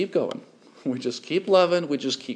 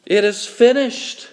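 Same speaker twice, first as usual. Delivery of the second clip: higher, louder anguished cry; Jesus on the cross crying out, higher and louder; cried out; 7 semitones higher, 6 dB louder than usual. Acted. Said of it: "he cried".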